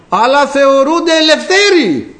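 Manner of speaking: high, drawn-out cry of disbelief; indignant exclamation. Real, not acted. Preacher decrying those who claim freedom, indignant exclamation. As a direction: stretch it out high in protest.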